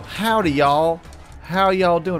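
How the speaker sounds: loud, high hammed-up southern greeting; mock southern American accent. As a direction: imitate friends overdoing a southern American twang. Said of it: "do an American accent".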